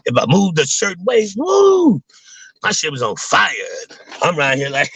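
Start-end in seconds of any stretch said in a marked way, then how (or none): 1.4-2.0 high, loud pained whoop; pained woo. Retold or imitated. Remembering the spasm, a loud high pained woo. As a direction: yelp it like a back spasm hit. Pain from moving his back.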